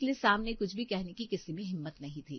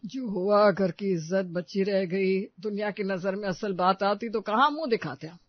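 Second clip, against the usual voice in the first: stern insistence; 5 dB louder than usual. Acted. Elder defends family honour.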